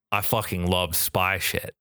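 ordinary talk

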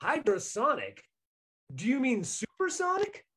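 incredulous. Retold or imitated. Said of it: "and I'm like".

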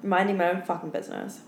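ordinary delivery

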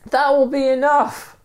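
very high-pitched call; high acted shout. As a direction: call it out over a distance. Calling across the drop.

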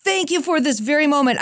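high, excited thanks to God; joyful shout. Retold or imitated. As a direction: burst out in praise. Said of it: "And I shouted".

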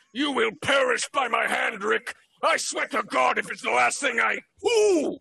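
loud, high threat cut off by a yelp; raging dragon. Acted. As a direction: roar vengeance as the dragon, then get struck. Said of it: "as the dragon says".

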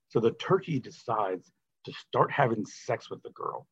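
ordinary talk, nothing marked

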